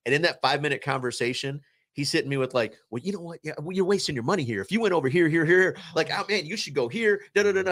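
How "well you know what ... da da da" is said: fast-talking friend. Retold or imitated. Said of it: "You're talking too fast".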